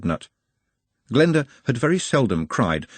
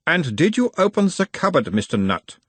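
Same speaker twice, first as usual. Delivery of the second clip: put-on a mild Uberwaldian accent, the higher voice of a gentle examiner; 8 semitones higher than usual. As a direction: question him in a light Uberwald accent, calming the patient. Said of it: "in a mild Uberwaldian accent".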